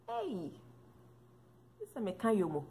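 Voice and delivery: normal talking voice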